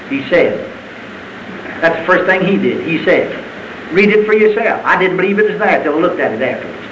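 normal talking voice

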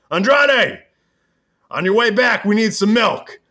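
high, loud call after someone leaving; Rick Flair. Retold or imitated. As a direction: call out in a hammed-up wrestler voice. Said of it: "Rick's like".

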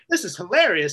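loud, laughing delight. Retold or imitated. Comic telling his friend how he enjoyed a heckler, laughing. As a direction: relish it while laughing.

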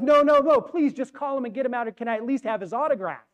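panicked fan pleading not to be sent away; begging fan. Acted. Fan begs guard.

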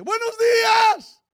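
very high, booming drawn-out call; shouted greeting. Acted. Preacher voicing God's sunrise greeting, shouted. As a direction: hail everyone with a long, loud shout.

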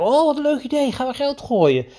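put-on high, eager play voice cheering on a boy; playful excited coach voice. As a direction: gush like you're thrilled by his idea. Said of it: "Dus ik zei".

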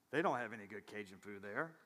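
low, muttered doubt about the food; sceptical local reaction. Acted. Pastor voicing locals who saw the restaurant sign, sceptical. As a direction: say it low, like a private verdict.

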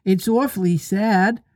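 put-on mildly chirpy little boy; cheerful. A narrator's reading. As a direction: say it cheerfully despite the sad words. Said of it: "the first twin said cheerfully".

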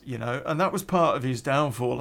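normal talking voice

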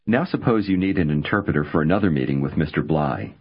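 ordinary delivery

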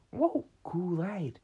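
high, loud, slow excited exclaim; excited whoa. Retold or imitated. Friend digs through the gift basket, excited mock delight. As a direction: marvel at a treat out loud.

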